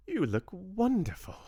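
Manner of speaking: high, bright greeting from a grandmother; lightly voiced grandmother; grandmother's voice. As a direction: greet them warmly as the old lady.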